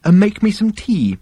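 high-pitched, reluctant admission; sheepish. A narrator's reading. Arthur admitting he jammed the computer, sheepish high voice.

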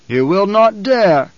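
slow, high, loud outraged warning; grating emperor. Acted. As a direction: grate it out slowly and loudly. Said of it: "the Emperor grated".

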